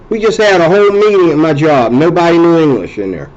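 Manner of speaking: ordinary delivery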